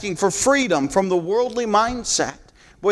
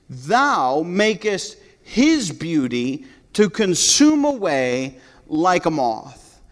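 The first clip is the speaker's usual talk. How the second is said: drawn-out, deliberate reading of the verse; slow scripture reading; slower than usual. Acted. Preacher rereading the moth verse to the congregation, slow and deliberate. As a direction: stretch each word of the line slowly.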